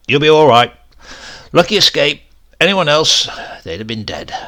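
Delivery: loud, cheery doctor's reassurance; young doctor cheerfully reassuring his patient; hearty doctor. Retold or imitated. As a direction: boom it out like a brisk young doctor.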